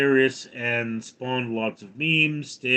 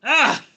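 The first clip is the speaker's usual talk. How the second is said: short, high, loud "ah"; sudden cry; 13 semitones higher, 7 dB louder than usual. Real, not acted. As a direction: burst out with a sharp ah. Sudden cry while reading.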